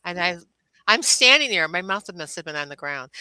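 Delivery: ordinary delivery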